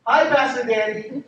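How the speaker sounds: high, loud, drawn-out call of hello; friendly greeting. Retold or imitated. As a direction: sing out a greeting across the street. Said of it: "this group of people would say".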